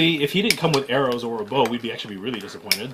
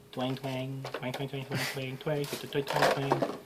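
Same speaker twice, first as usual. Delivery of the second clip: twang noises; 9 dB quieter, slower than usual. Pretend. Plucking the toy bow string.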